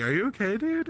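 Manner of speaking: mock concerned friend, rising high; alarmed bro checking in, squeaky high; worried texter. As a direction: pitch jumps way up on dude. Friends texting back worried.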